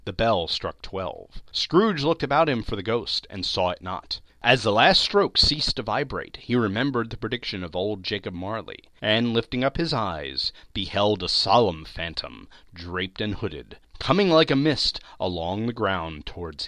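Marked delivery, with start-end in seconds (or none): none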